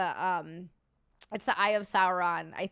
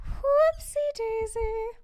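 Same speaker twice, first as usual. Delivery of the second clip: cutesy whoopsie; 12 semitones higher, 5 dB louder, much slower than usual. Real, not acted. After a slip, "oops.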